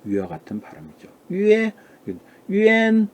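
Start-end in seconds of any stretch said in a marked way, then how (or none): 1.3-3.1 유에, 유엔 said high, one by one; teacher modelling 유에 and 유엔 slowly; pinyin pronunciation. Shown as a demonstration. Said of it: "유엔이라고 발음해야 됩니다".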